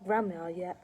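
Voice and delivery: ordinary delivery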